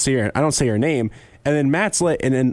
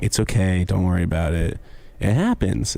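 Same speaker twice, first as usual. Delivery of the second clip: mock reassuring; 10 semitones lower than usual. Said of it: "and I was like".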